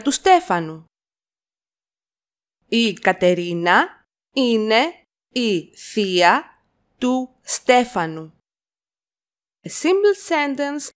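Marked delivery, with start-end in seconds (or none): 2.7-8.3 slow it down for copying; slow chunked repeat. Shown as a demonstration. Teacher to learners, slow chunked model. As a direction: split into two chunks with a pause. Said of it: "teacher repeats in chunks".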